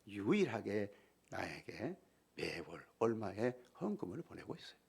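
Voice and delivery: usual speaking voice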